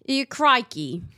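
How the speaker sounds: put-on Australian twang; playful Australian accent. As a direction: toss out a mock Australian catchphrase. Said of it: "trying to go for Australian".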